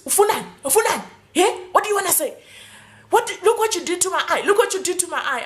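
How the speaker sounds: injured cop confronting her, lightly mimicked; mock angry cop. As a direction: snap back as the cop.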